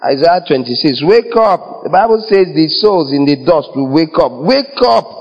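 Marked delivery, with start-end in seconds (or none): 1.1-1.8 high-pitched mock wake-up call. Acting out waking the dead. 4.4-5.1 high, acted rousing call; calling to wake. Acting out waking the dead.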